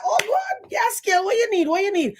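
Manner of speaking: very high excited welcome; delighted. Retold or imitated. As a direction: gush like a new friend. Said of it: "She said".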